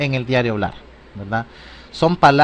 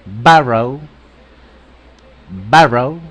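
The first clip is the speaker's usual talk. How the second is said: "borrow" hit louder on its first vowel, said as A; stress on first syllable; 5 dB louder, slower than usual. Shown as a demonstration. Teacher models the accent on A, "borrow" stressed up front. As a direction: punch the A sound in "borrow". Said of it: "acento en la A".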